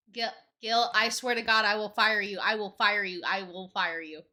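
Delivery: loud, fed-up yell at a pest; yelling at Gil. Real, not acted. Streamer yelling at cameraman Gil, loud and exasperated. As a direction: shout him off, half laughing.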